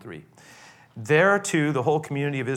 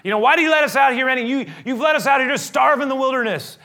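higher, louder indignant shout; shouting blame at the leader; angry outburst; 12 semitones higher, 8 dB louder than usual. Retold or imitated. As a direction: hurl the accusation, shouting. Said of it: "throwing off things like".

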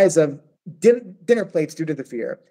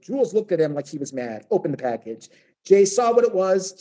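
ordinary talk

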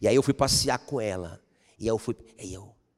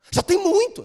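high, loud exclaim; mild mock protest; 5 dB louder than usual. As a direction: squeal the protest.

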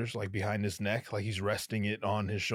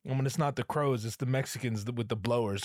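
normal talking voice

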